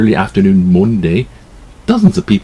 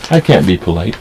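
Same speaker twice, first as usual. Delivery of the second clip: ordinary talk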